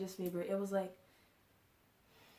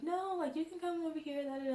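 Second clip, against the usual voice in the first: higher-pitched, light retold reply; imitating friendly strangers; 8 semitones higher than usual. Concertgoers offering an empty seat, imitated high-pitched welcome. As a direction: voice someone else's easy invitation, pitched up. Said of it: "And they're like".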